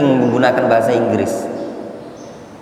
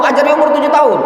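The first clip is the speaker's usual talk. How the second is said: voicing the Prophet; 11 semitones higher, faster than usual.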